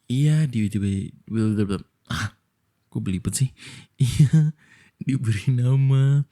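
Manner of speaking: ordinary delivery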